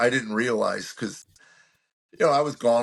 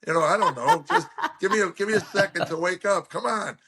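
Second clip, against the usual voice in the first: higher voice, laughing through a mock protest; laughing rant; 9 semitones higher than usual. Real, not acted. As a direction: rant while cracking up. Ranting at dinner question, laughing.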